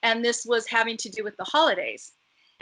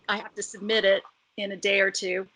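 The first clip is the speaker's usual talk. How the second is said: ordinary talk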